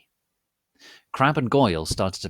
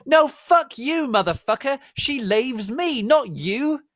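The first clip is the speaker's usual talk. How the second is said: shouting; 6 dB louder than usual. Acted. Vampire screaming at Draco, very high shriek. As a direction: scream it possessively. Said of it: "shouted Vampire".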